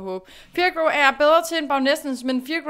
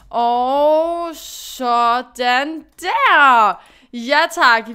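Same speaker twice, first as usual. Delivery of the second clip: slow, drawn-out, louder victory cry; triumphant; 7 dB louder, much slower than usual. Real, not acted. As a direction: savour the win, stretching it out. Finishing in second place.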